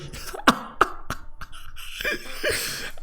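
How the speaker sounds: breathless high wheeze laugh; wheezing laugh, no voice; high silent laugh. Real, not acted. Laughing at Tempura.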